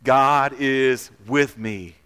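loud, drawn-out words of conviction; meaning it. Shown as a demonstration. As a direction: say it slowly like you mean it. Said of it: "and mean it".

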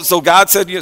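ordinary delivery

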